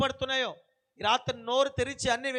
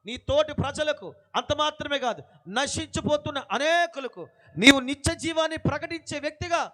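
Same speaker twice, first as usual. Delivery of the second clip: rising, louder preaching refrain; fervent preaching; 5 dB louder than usual. Acted. Preacher urging the church to bear fruit, fervent build. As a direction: build each nuvvu phaliste line to a peak.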